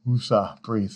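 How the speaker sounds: normal talking voice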